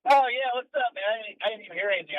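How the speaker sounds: normal talking voice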